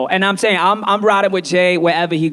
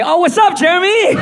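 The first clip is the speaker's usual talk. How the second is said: high, rising burst of recognition; excited greeting; 10 semitones higher, 3 dB louder than usual. Acted. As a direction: greet him like you suddenly know him. Suddenly recognizes his texting friend.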